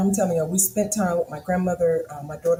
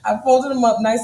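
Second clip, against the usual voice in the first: normal talking voice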